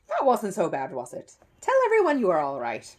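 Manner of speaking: a stage magician keeping up appearances; lightly voiced showman; magician's stage voice. Magician reassures Paddington on stage.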